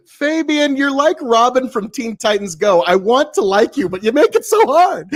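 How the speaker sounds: high, loud mock whine; whiny teen voice.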